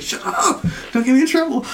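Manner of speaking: high, laughing imitation of a panicked hush; mock panicked shushing. Retold panicked shushing during prayer.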